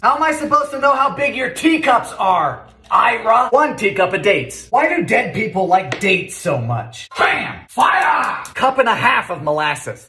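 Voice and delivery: ordinary delivery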